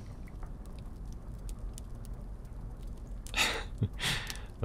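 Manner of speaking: gentle breathy chuckle; quiet warm chuckle; soft laugh. Acted. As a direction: laugh softly to reassure. Soft chuckle while comforting.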